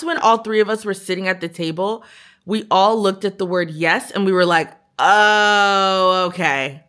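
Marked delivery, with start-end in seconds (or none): 5.0-6.8 dawning realization. Retold or imitated. Three friends read his reply at the table, knowing retold realization. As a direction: draw out the oh as it sinks in.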